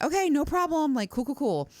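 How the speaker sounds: chirpy fake-easygoing reply; mock breezy. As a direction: toss it off brightly like it's nothing. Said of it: "And I'm like".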